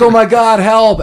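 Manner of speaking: high, loud mock panic yelled for help; mock cry for help. Patricia grabbed from behind, crying out for help in mock panic. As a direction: squeal it like a helpless victim. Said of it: "And she's like".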